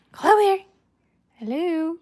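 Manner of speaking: high pet-talk call; calling the dog. Real, not acted. Owner calling her dog to come, high coaxing. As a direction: coax in a high, lifted voice.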